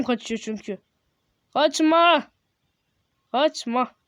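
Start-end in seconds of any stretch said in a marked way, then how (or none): 1.5-2.3 louder, higher call not to flee; raised-voice command to stay. Real, not acted. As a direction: shout after someone running off. Calling to fleeing figure.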